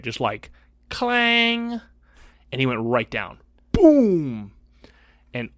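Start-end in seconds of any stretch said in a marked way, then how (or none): 0.9-1.8 clang sound. Retold or imitated. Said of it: "imitating lunchbox hitting forehead". 3.7-4.5 boom sound. Retold or imitated. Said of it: "imitating kid falling down".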